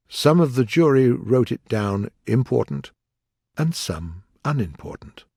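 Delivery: plain reading voice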